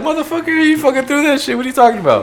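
high indignant put-on voice; high hammed-up indignation; mock outrage. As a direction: call out the fake ad in mock fury. Mocking the staged ad.

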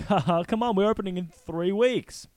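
rally the troupe cheerfully; cheery showman. Retold or imitated. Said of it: "they're like".

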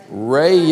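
"rest" drawn out as "ray-est"; "rest" as a long Southern "ray-yest"; two-syllable Southern rest. Shown as a demonstration. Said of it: "say it with two syllables and really slowly".

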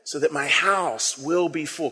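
So that my house will be full drawn out slowly and softly; slow, soft reading. Shown as a demonstration. Pastor modelling the line slowly and softly for the congregation. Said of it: "Can we say that line together?".

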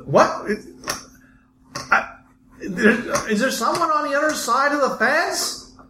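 startled voice. Acted.

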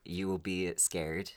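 ordinary delivery

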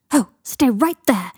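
loud, drawn-out order; urgent "don't move" shout; excited command. A narrator's reading. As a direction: call it out high, slow and loud. Millicent orders them to stay.

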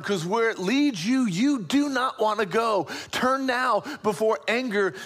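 urgent plea. Acted. God urging Cain to turn back, urgent plea.

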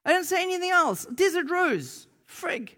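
very high, loud disbelief; astonished protest. Retold or imitated. Amazed protest at man's tears.

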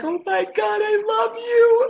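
high, slow and teary with relief; squealing gratitude; overjoyed. Retold or imitated. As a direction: gush with relief. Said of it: "And I was like".

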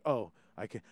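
low, soft, measured public-radio voice; NPR voice. Retold or imitated. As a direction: speak like a hushed NPR host. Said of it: "speak in N P R voice here".

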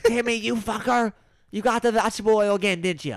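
a mother busting her son over the oil; scolding mom. Pretend.

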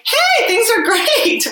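high, slow, sing-song fake cheer; hammed-up everything's-fine voice; mock cheery past self. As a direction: play the oblivious happy younger self. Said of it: "the place where I'm like".

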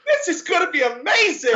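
high, loud gleeful laughing shout; excited laughing shout. Retold or imitated. Stranger behind him on the ride, gleeful laughing shout. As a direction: cackle it like he knows what's coming. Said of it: "And he goes".

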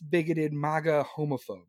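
ordinary talk, nothing marked

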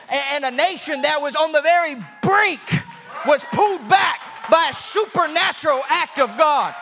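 high, loud, rising sermon climax; preaching at full force. Acted. Preacher telling the congregation of the miracle rain, full-force preaching. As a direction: preach it out like a revival.

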